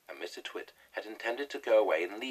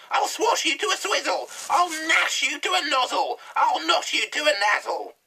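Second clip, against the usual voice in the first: much higher, louder furious witch yell; yelling hag; 14 dB louder than usual. A narrator's reading. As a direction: bellow insults from the sky like a wild hag. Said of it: "she shouted".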